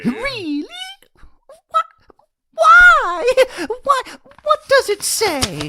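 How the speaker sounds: loud, high, rapid-fire what and why; frantic eager questions. Acted. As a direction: burst out with impatience. Frantic questions about the letter.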